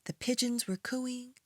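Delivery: put-on gentle read-aloud for children; storytime voice. A narrator's reading. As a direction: read it like a bedtime picture book. Reads a picture book aloud.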